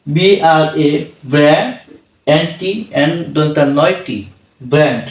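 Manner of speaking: Brand spelled piece by piece with pauses; teacher's segmented spell-out of brand; broken-up spelling. Shown as a demonstration. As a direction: break brand into parts, naming each letter. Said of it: "আমরা একভাবে পড়তে পারতাম".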